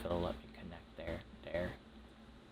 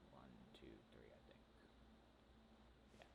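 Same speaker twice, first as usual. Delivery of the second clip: whispered counting under breath; whispering; 8 semitones lower, 26 dB quieter, faster than usual. Real, not acted.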